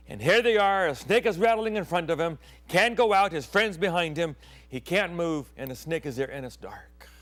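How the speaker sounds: ordinary talk, nothing marked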